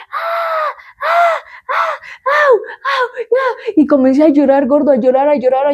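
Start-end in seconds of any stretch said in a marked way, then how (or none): 0.1-3.7 very high drawn-out wails; mock cries, pitched way up; imitated crying in pain. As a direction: re-enact wailing from bodily pain. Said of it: "comencé a llorar, y yo así".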